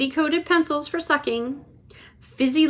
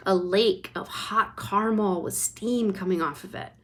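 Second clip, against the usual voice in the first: usual speaking voice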